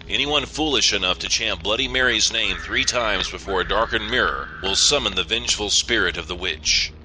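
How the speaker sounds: usual speaking voice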